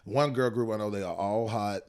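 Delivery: usual speaking voice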